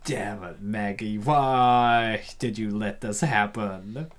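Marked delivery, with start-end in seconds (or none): none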